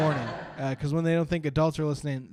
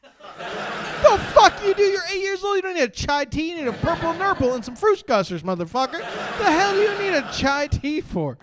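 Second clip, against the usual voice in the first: much higher, louder mock outrage; mock outraged rant; 13 semitones higher, 6 dB louder than usual. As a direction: scold the kid in high indignation. Comic rant at the kid.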